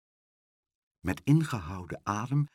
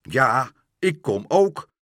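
loud, sarcastic angry retort; angry park keeper; 6 dB louder than usual. Acted. The park keeper angrily answers the counting workman, loud. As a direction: snap back in fury. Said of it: "riep de parkmeester woedend".

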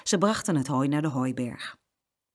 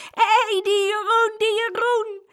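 much higher, louder giddy shriek; shrieking with laughter; 5 dB louder than usual. Acted. Saskia howls at Jeroen covered in flour, giddy shriek. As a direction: hoot at your floury brother. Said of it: "gierde het uit".

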